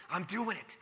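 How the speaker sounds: put-on high, pleased kid voice of a five-year-old; smug child announcing success; proud little boy. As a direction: chirp it like a kid who found a loophole.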